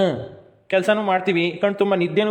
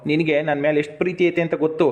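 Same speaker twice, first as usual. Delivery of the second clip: usual speaking voice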